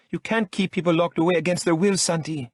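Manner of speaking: woman protesting being locked up; Lola protesting. A narrator's reading. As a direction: protest with outrage.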